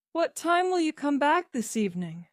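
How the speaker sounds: a mother's mildly anxious asking. A narrator's reading. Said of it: "asked Missus Jones anxiously".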